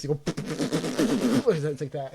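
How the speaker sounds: slow sputtering engine noise; old car sputter. Retold or imitated. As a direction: crank it like a cartoon car. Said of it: "Like in the cartoons".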